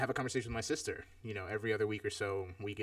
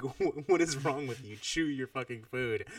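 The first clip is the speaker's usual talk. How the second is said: mock tearful scolding; 3 semitones higher than usual. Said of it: "tears streaming down her face".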